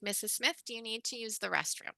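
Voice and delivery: soft, respectful, plain question; teacher models dignified adult-to-adult talk. Shown as a demonstration. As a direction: speak to her as an equal. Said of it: "I might say something like".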